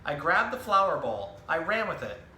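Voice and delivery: lively picture-book storytelling voice, each line clearly paced; reading to children. Acted. Zookeeper reading a book to children, animated storytelling. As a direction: tell the story to a room of young kids.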